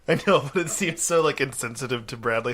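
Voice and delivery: ordinary talk, nothing marked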